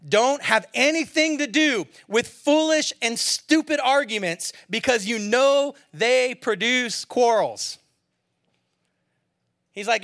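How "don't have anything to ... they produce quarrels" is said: loud, slow, emphatic scripture reading; forceful reading. Real, not acted. Pastor booming the verse at the congregation, slow and loud. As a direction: press every word of the verse home, slowly.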